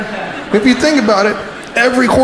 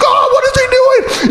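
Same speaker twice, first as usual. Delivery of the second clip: high-pitched alarmed cry; mock panic; faster than usual. Rider watches horse go under, mock panic.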